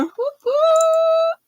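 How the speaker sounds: a very high squeal of whoop whoop; high cheering whoops; excited whoop. Real, not acted. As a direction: squeal with glee over the color. Cheering about the yarn color.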